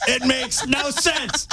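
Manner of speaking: high, slow, laughing through the words; can barely get it out for laughing. Real, not acted.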